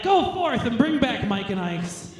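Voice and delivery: slow, lordly decree to a servant; hammed-up queen sending her minion off; mock royal command. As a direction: play a queen giving orders, slowly. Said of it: "I can be like".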